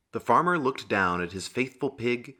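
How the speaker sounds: ordinary delivery, nothing marked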